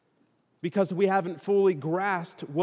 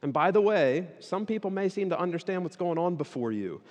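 normal talking voice

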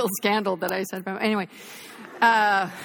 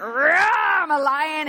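loud high mock roar; lion roar; 5 semitones higher, 4 dB louder, much slower than usual. Brother playing a roaring lion for little Judah, loud mock roar. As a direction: roar like a pretend lion for a toddler.

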